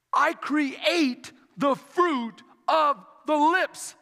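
"I create the fruit of the lips" very slow, word by word; slow, deliberate quote. A narrator's reading. Preacher quoting Isaiah to the church, slow and weighty. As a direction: space out "I create the fruit of the lips" with a pause between words. Scripture quoted slowly, then repeated flowing.

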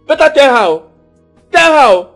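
loud, insistent command; angry warning. Acted. Angry relative issuing a warning.

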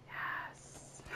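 mock secret whispered yes. Hiding in the box, whispering to himself. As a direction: hush it gleefully.

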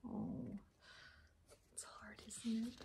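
soft, tender whisper; hushed gentle reassurance; whispered sympathy. Real, not acted.

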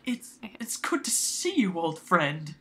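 barely getting words out. Shown as a demonstration. Director showing actress an old friends' reunion, choked. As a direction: struggle to get the first words out. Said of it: "like she can barely get the words out".

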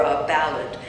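ordinary talk, nothing marked

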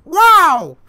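very high, explosive yell; piercingly loud and high test shout; loud weird shout. Shown as a demonstration. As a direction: blast out a sudden silly high shout. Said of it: "I can do weird sounds loud".

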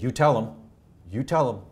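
a dying woman's sudden fire, pressing the point; fiery insistence. Retold or imitated. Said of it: "she gets this fire in the eye".

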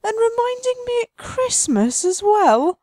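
high, loud, slow mock-wounded wail; hammed-up outrage. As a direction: overplay the grief over the dead horse. Mock grief over dead horse.

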